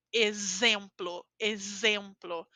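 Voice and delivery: slow exemplo with the X buzzing like Z. Shown as a demonstration. Teacher demonstrating X between vowels. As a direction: stretch the Z sound in exemplo. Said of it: "então eu falo z".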